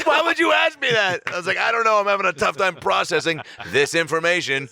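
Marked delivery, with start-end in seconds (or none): 0.0-1.2 very high, loud shriek of protest; hammed-up indignant cry; imitated outraged wail. Retold outraged reply, crying girlfriend. 1.6-4.7 high, exasperated self-defense; comic overwhelmed protest; acted flustered outburst.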